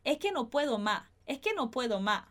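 es que no puedo más with every s left out; dropped s. Shown as a demonstration. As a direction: say e ke no puedo ma, no s at all. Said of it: "poderiam até não pronunciar esse S".

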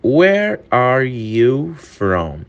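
very slow, every word laid out for learners; slow, careful English model reading; slow demo. Shown as a demonstration. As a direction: spell it out slowly for students. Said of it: "repeated at normal pace".

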